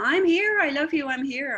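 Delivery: high, loud, insistent declaration; emphatic reassurance. Acted. Loved ones trying to let you know, emphatic reassurance. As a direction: call it out to get through. Voicing spirits trying to get through.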